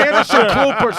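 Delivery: Anderson Klooper, with Klooper said like "clooper"; mispronounced name. Retold or imitated. Imitating the funeral director's slip, "Klooper" for Cooper. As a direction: say "Klooper" with an added L, mocking. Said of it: "he called him Anderson Klooper".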